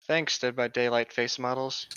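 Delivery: ordinary delivery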